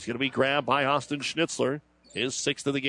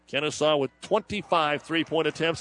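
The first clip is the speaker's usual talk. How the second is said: usual speaking voice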